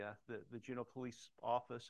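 ordinary talk

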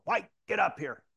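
captain's summoning shout, acted out; imitated yell. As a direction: yell it like a boss summoning someone. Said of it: "the captain yells out of his window".

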